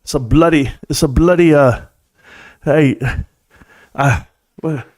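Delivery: halting, hammed-up British accent; clumsy mock British delivery; bad British accent. Shown as a demonstration. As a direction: attempt a British accent and falter. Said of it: "that was a terrible British accent".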